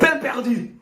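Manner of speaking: French naming the bread; French accent. Acted. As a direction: say it proudly like a Frenchman. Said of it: "French, what you got?".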